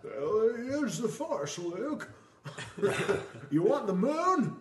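put-on high, slow drawling old-film star voice; mock Jimmy Stewart drawl, high and slow; Jimmy Stewart impression. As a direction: play a folksy Jimmy Stewart, hammed up through laughter. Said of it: "Jimmy Stewart".

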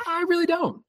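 very high, mock-curt squeak; blunt refusal. Refusing to talk with the date, high mock squeak. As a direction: squeak out a flat no. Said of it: "And I was like".

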